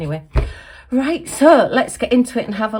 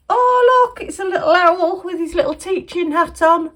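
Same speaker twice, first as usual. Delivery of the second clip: higher, cooing over the owl; delighted coo; 8 semitones higher, 4 dB louder than usual. Real, not acted. Showing the owl gift to viewers, cooing.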